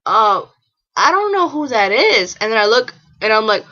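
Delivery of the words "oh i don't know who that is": mildly puzzled, pitched up and a bit loud; confused girl. Retold or imitated. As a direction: imitate a baffled window worker. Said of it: "like, all confused".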